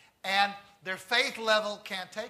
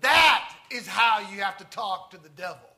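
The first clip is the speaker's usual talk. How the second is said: loud outburst, hard on "That"; yelling out; 9 dB louder than usual. Retold or imitated. Wigglesworth, mesmerized onlooker, suddenly yelling out. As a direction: blurt it out at full volume. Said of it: "he yells out".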